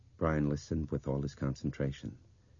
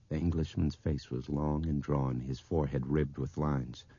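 plain reading voice

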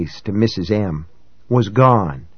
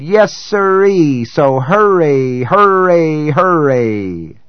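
lurid sideshow teasing; 7 semitones higher than usual. A narrator's reading. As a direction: hawk the freak show to the passing crowd. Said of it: "the hoarse cry of a sideshow barker".

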